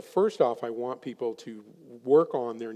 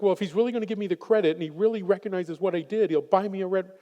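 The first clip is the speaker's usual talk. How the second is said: higher, louder and fast, insisting; imitating the wife; 8 semitones higher, 6 dB louder, faster than usual. Wife explaining the Ferrari to therapist, higher and fast. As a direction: rattle it off as the demanding wife. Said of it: "And she's like".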